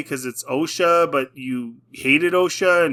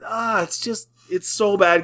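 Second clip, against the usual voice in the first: ordinary talk, nothing marked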